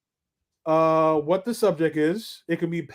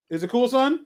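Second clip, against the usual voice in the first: normal talking voice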